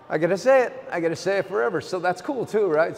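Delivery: choked-up, cracking voice; voice breaking. Real, not acted. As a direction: push through the lump in the throat. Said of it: "don't know why my voice breaks up like this".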